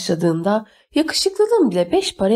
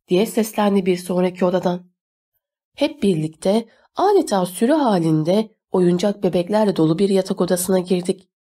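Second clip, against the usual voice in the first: usual speaking voice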